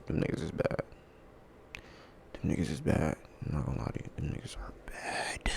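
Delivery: dropping away into a whisper; quiet, confiding repetition, sinking to a whisper; hushed verdict. Real, not acted.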